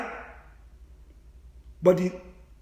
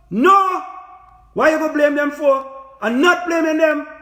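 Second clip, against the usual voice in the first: emphatic protest; 4 semitones higher than usual. Real, not acted. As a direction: burst out with a raised, indignant no. Emphatic denial, arguing to viewers.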